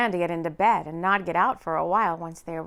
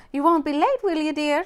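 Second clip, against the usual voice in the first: teasing woman; 6 semitones higher, 6 dB louder than usual. A narrator's reading. Teasing partner on the phone.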